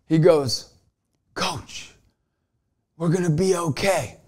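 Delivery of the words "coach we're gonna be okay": quiet reassurance. Retold or imitated. Luigi quietly reassures his coach.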